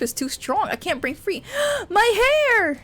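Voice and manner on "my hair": high, loud gasped cry; pained gasp. Acted. Heroine grabbed by the hair, yelping in pain. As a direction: yelp as your hair is yanked. Thomas grabs her hair.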